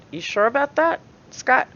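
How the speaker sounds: pointedly incredulous; mocking challenge. Real, not acted. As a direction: needle him with a high, doubting question. Challenges Scott's claim mockingly.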